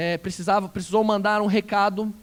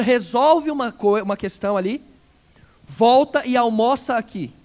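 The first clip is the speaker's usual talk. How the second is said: louder, driving pulpit voice with slowed punches at the end; forceful preaching; 6 dB louder than usual. Acted. Pastor urging the congregation, forceful preaching. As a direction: preach it out to the whole church.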